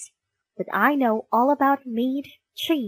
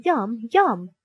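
ordinary delivery, nothing marked

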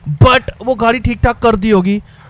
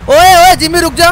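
louder call, voice rising; 4 semitones higher, 6 dB louder than usual. Real, not acted. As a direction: yell to make him stop. Calling Jimmy to stop.

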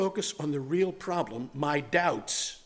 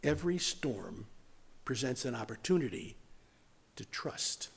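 softer, lower, spaced with pauses; hushed repeat; 5 semitones lower, 9 dB quieter than usual. Shown as a demonstration. Preacher repeating the key point to the congregation, hushed. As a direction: repeat it quietly, phrase by phrase. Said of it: "I'll repeat that".

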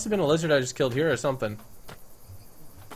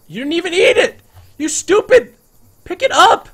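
much higher, louder scolding; mock angry yelling; 12 dB louder than usual. Yelling at the sibling raptor for not eating, mock furious. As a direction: berate the dumb raptor at full volume.

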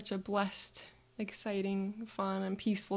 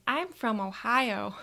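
lightly voiced little kid, slow and louder; 2 semitones higher, 7 dB louder, slower than usual. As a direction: answer like a proud little kid.

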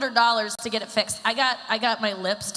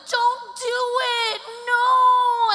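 much higher, louder mock wail of warning; friends pleading; 6 dB louder, much slower than usual. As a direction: shriek it like panicked friends. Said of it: "all my friends were like".

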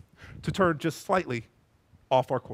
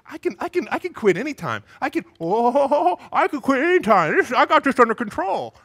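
louder, cocky bluster; overconfident Samson; 5 semitones higher, 5 dB louder than usual. Pretend. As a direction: insist you're in control.

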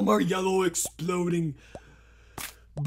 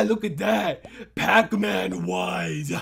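ordinary talk

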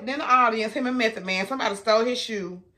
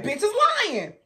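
much higher accusing yell; high mock yell; 13 semitones higher than usual. Teller mimicking the movie line, high and accusing. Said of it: "he said".

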